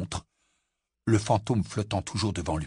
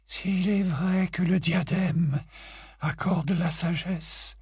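high, slow, controlled ghostly tone; steady your voice with effort; composing herself; 8 semitones higher, slower than usual. A narrator's reading. Grey Lady regaining control before Harry, slow and measured. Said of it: "en faisant un effort évident pour se ressaisir".

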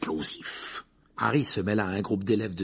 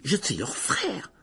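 high, slow indignation; scandalised; 7 semitones higher, slower than usual. A narrator's reading. Ron protesting as the slighted brother, scandalised. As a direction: drag out the protest, pitch raised. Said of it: "dit Ron scandalisé".

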